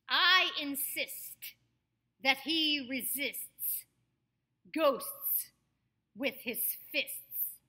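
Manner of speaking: slow, loud, every S and T sounded in INSIST, RESISTS, GHOSTS, FISTS; over-articulate the STS ending of FISTS, pausing between phrases; crisp S's and T's. Shown as a demonstration. Coach demonstrates a tongue twister, FISTS with crisp S-T-S. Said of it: "help us with our S's and our T's".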